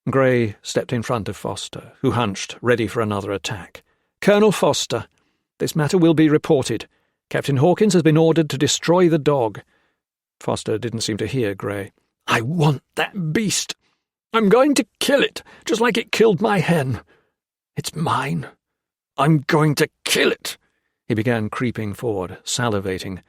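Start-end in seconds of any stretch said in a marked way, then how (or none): 4.2-9.6 high, loud formal warning; stern formal. Acted. 12.2-20.6 high, loud obsessive threat; manic menace. Acted. Foster obsessed.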